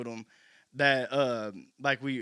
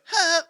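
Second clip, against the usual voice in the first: mock ad-lib grunt; 7 dB louder than usual. Imitating the chorus ad-lib.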